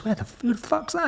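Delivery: squeaky high astonished question. Retold or imitated. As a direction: replay the shock of a teenager. Asking who the stranger was.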